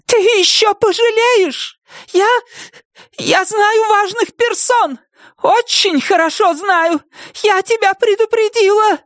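very high, thin, shrieking; shrill cry. Acted. Nettie warning Wilma, shrill thin cry.

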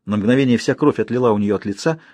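ordinary delivery

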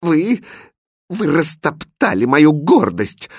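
high, wounded malicious accusation; princess's vicious, halting reproach; spiteful. A narrator's reading. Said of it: "злобно сказала принцесса".

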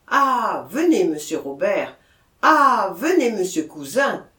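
loud, urgent welcome; mayor hailing the doctor and police; eager calling out. A narrator's reading. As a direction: call them over in relief. Mayor calls out greeting arrivals.

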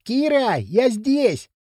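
high-pitched call across a distance. A narrator's reading. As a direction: call to a friend far away. Boy calling across the ravine.

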